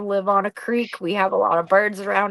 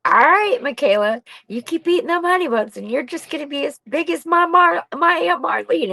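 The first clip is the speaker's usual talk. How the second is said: high-pitched, quick scolding of a daughter; mock motherly warning; 6 semitones higher than usual. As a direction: tease her with a raised, sing-out warning. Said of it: "I used to tell her".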